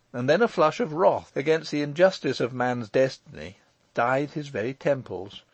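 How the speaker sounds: ordinary delivery, nothing marked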